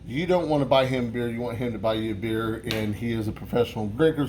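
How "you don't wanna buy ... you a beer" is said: ordinary talk, nothing marked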